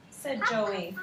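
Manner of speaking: usual speaking voice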